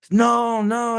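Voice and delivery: high, loud mock begging; imitated scream. The guy begging for his life, mock scream. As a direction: squeal no in panic. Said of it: "he's screaming".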